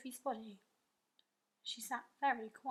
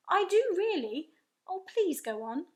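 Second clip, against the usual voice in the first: eager cry; 8 semitones higher, 6 dB louder than usual. A narrator's reading. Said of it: "Sophie cried".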